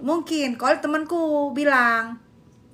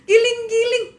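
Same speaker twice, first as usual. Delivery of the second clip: laughing cry; 8 semitones higher, 4 dB louder than usual. Real, not acted. Crying out while laughing.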